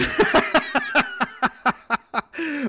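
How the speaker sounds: long, high-pitched laugh; hearty laughing. Real, not acted. Laughing about being twenty again, hearty laugh. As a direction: burst out laughing and keep going. Cracks up at own nostalgia.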